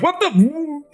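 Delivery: startled guard. Acted. Guard suddenly swallowed by darkness.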